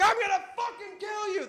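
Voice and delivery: high, distant-sounding furious yell; imitating an enraged pursuer; yelled threat. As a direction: threaten someone from down the street. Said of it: "running behind me yelling".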